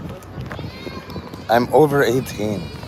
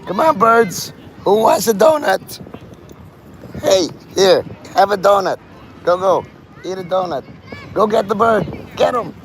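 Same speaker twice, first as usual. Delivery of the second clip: excited calling; 5 semitones higher than usual. Real, not acted. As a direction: egg the birds on, playful. Calling and egging on birds.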